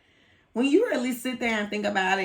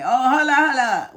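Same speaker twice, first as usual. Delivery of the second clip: loud, higher retold caller; retold interruption; 6 semitones higher, 8 dB louder than usual. Retelling the supplier's phone call, loud and higher-pitched. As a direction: cut in loudly, mimicking the caller. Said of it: "he had a real thick accent".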